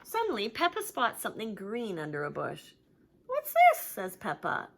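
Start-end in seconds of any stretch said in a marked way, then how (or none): 3.3-3.8 very high, loud wondering question. Acted. Peppa finds something under a bush, very high and curious. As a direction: ask in delight.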